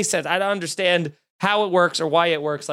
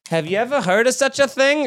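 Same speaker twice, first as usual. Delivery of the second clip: put-on higher-pitched astonished old lady; old diner woman; 6 semitones higher than usual. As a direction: imitate an elderly diner owner's catchphrase. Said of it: "it was like her catchphrase".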